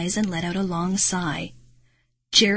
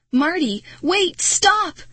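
high, loud cries; urgent shout; 10 semitones higher, 4 dB louder, slower than usual. A narrator's reading. Girl shouting to Marty as she runs, urgent. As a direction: shout desperately after someone running ahead. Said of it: "I shouted as I ran".

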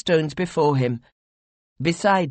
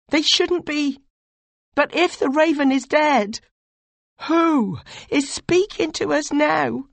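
higher, louder, urgent pleading; anxious young advisor; 12 semitones higher, 4 dB louder than usual. Acted. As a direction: press your lord with dread and worry. Voiced "you" character pleads at length.

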